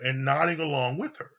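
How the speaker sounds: ordinary talk, nothing marked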